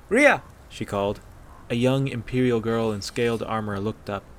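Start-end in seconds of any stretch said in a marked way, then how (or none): 0.1-0.4 high, loud summoning call. A narrator's reading. Aella summoning an archer from the practice yard, calling out. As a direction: call down to someone at a distance. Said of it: "called down to the practicing archers".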